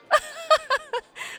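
squeaky waitress laugh; shrieky character laughter; high-pitched giggle. Acted. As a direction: burst into a very high put-on giggle.

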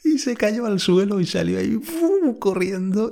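high, excited rush; excited retelling. Retold or imitated. Telling how the spider ran off, high excited voice. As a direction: pitch the story up with excitement.

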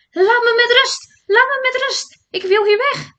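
high, loud panicked yell; frightened shouting. A narrator's reading. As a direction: scream it out in terror. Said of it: "Hij begon te roepen". (hoog en luid paniekerig roepen)

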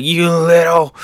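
hurt, angry Kaido. Acted. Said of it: "He's hurt".

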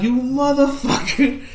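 a mock-outraged curse, cracking up; laughing mock curse. Cursing the friend who ran off, laughing. Retelling friend abandoning him.

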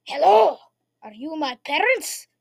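loud hello in a put-on kid accent; little boy Jimmy. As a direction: play an exaggerated little kid, drawn out. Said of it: "he said that in that exact accent".

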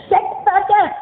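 high mock female yell. Girl in his story snapping back, a high mock yell.

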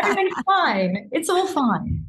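high, bright, forced everything-is-fine voice; mock cheerful denial. To her co-host, pretending she's not falling apart, high forced cheer. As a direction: chirp it up high as if nothing happened.

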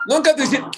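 "Nunca" punched high and loud; emphatic "Nunca" again rising. Real, not acted. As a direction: press the "Nunca" hard. Emphatic repeat.

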